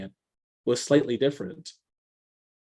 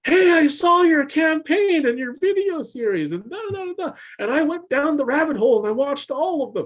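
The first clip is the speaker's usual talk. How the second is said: gushing fan; 13 semitones higher, 4 dB louder than usual. Retold or imitated. Convention crowd praising the friend's videos, high gushing. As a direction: gush like an adoring fan. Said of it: "Everyone's like".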